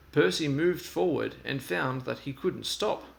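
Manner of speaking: ordinary delivery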